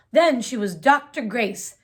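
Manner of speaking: plain reading voice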